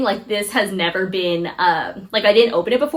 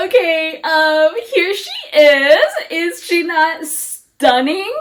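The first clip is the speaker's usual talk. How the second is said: tearful awe; 6 semitones higher, much slower than usual. Real, not acted. Tearful unboxing of dream bag.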